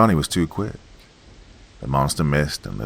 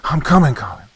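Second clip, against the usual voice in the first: much higher and louder, urgent; shouting; 10 semitones higher, 7 dB louder than usual. Acted. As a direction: yell ahead to the screaming brother. Boy racing to rescue brother.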